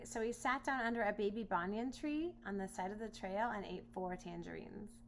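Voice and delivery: ordinary delivery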